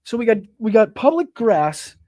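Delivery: mocking disbelief. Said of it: "There was a permitted protest".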